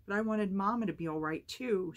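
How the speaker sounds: put-on high, loud childlike wail. A narrator's reading. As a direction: protest in a loud, upset wail. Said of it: "Sammy wailed".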